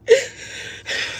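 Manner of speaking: high unvoiced sob-laugh; a real crying gasp breaking through; crying sob with a broken laugh; breathless sob. Real, not acted. Breaks down mid-story.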